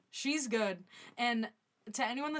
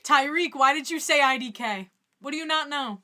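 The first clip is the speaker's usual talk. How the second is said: much louder, hammed-up fury; mock angry yell; 3 semitones higher, 12 dB louder than usual. Calling out a viewer's comment, hammed-up angry yell. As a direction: blow up at the chat for show.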